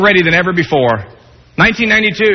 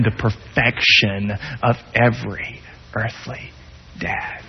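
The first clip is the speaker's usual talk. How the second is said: much softer, slowing down, ending on a whisper; fading to a whisper; 10 semitones lower, 9 dB quieter, slower than usual. Acted. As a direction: let it fade into a hushed whisper. God's promise quoted, closing sermon.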